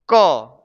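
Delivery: क as a firm, falling "kuh"; correct pronunciation model. Shown as a demonstration. Teacher showing how little to open the mouth, small vowel. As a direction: say क alone, mouth barely open. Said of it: "मुंह को कम खोलना है".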